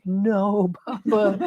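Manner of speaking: put-on a small boy insisting to his father; little son's voice. Little son insisting to his papa, high childlike voice.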